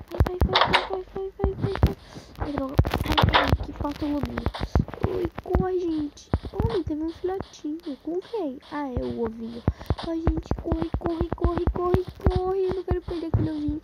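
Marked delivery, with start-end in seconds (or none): 0.1-2.0 high, loud hurried urging; frantic urging. Real, not acted. 10.1-13.8 scared horror game streamer. Real, not acted. Panicked urging.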